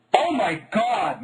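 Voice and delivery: hammed-up alarm from a mother; mom screaming. A narrator's reading. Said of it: "Mom screamed".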